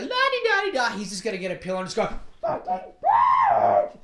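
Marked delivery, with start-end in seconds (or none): 0.0-1.0 high, sing-song la-di-da; mock sing-song coworker. Mocking coworker breezing in. 2.4-4.0 high, slow, loud pillow scream. Pretend. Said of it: "Screaming in the fucking pillow".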